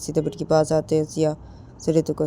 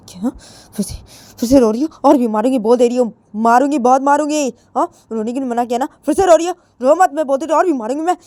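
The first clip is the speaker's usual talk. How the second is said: much higher, louder, fast rant; furious shouting; 9 semitones higher, 8 dB louder, faster than usual. Acted. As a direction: scream threats while beating her. Jiya beats and yells.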